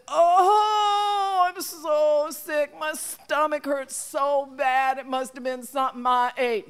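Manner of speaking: high, slow put-on whimper of pain; fake sick moaning. Rabbit faking a stomach ache so Wolf will carry him. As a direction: ham up being terribly ill.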